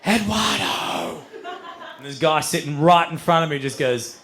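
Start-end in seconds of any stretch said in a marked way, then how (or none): 0.0-1.2 long, higher-pitched drawn-out call. Acted. Friends calling for a dealer among strangers, drawn-out call. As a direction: call the name out slowly, stretching it.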